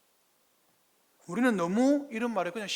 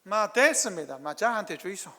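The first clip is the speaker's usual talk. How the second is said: blunt Gyeongsang dialect refusal, slightly louder; 2 semitones higher, 6 dB louder than usual. Retold or imitated. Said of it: "경상도 말로".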